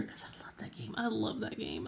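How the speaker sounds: hushed whisper of adoration; whispered confession. Real, not acted. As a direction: whisper your love of a game. Confiding love of the game.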